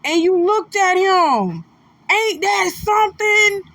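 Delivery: high-pitched awe at the moment he looked; amazed. Real, not acted. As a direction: marvel out loud, overcome. Marveling at Denzel's story.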